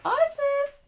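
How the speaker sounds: high, carrying call for the dog. Real, not acted. Owner calling her dog back home. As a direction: call her name across the house.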